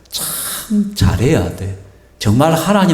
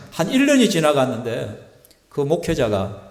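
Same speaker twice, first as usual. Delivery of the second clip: usual speaking voice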